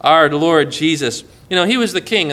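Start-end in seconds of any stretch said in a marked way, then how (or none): none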